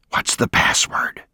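unvoiced whisper of the password. A narrator's reading. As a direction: breathe the question, no voice. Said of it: "whispered Haney".

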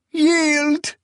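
high-pitched, acted sob; desperate sobbed wail; sobbing plea. A narrator's reading. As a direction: cry it out high, begging. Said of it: "she sobbed".

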